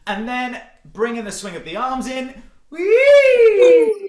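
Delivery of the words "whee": high, loud piercing twang; piercing wheee sliding down, little effort. Shown as a demonstration. Coach recaps the second setting, piercing twang. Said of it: "So this is your twang".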